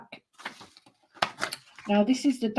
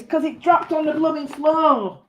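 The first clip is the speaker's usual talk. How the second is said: exasperated; 8 semitones higher than usual. Real, not acted. Exasperated at dropped item.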